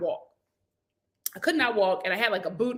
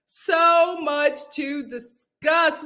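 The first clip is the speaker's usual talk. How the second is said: very slow drawn out; 5 semitones higher, much slower than usual. Shown as a demonstration. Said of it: "We have so much to discuss".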